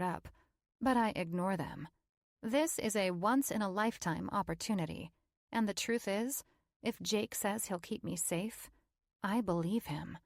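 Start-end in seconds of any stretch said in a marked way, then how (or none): none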